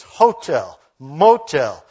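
Eastern Kentucky first-syllable stress, HO-tel, MO-tel, IN-surance; Eastern Kentucky accent. Shown as a demonstration. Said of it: "because in Eastern Kentucky it's".

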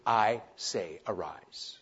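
low, soft, assured command; hushed but certain; quiet authority. Acted. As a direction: command it calmly without raising the voice. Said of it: "He just said with authority".

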